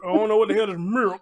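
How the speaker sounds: ordinary talk, nothing marked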